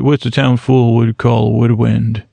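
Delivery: usual speaking voice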